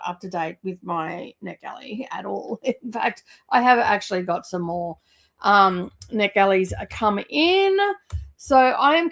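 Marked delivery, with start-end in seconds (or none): 0.0-3.2 guilty little whisper; sheepish whispered admission about the backlog. Real, not acted. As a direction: confess it under your breath. Confessing NetGalley backlog aside.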